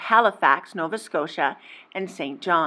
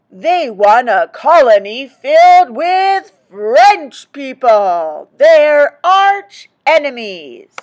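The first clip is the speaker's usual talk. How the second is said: slow, loud and higher, mock-outraged; dramatic loud reveal; 5 semitones higher, 10 dB louder, slower than usual. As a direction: boom it out like a shocking twist.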